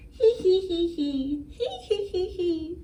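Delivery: high cackling hee hee; witch cackle. Pretend. Witch cackling good night.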